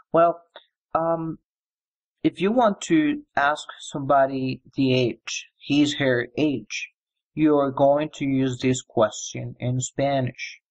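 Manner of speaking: ordinary talk, nothing marked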